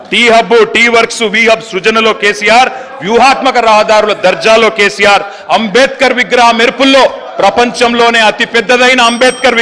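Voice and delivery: declaimed political litany with a repeated refrain; rhetorical roll call. Real, not acted. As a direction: build a rhythmic list, landing each refrain firmly. Chanting roll-call in assembly speech.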